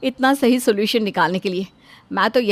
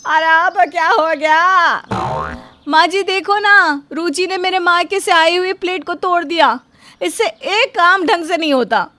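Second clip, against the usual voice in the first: upset complaint; 8 semitones higher, 5 dB louder than usual. Acted. Bahu complaining to her saas about the broken plate, high and loud. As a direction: whine to the mother-in-law about the plate.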